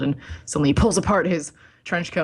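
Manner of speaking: ordinary delivery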